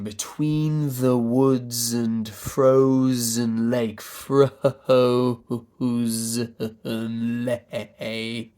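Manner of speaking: slow joined verse, building into breath-pulsed "frozen lake"; smooth line, then bounce the vowels in "frozen lake"; linked then pulsed. Shown as a demonstration. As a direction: draw out every vowel of "between the woods and frozen lake".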